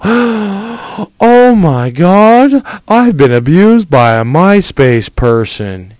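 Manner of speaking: high, loud, gasping mock victim voice; mocking girl. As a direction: ham up a shocked girl. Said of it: "You wanna let your fucking kid go".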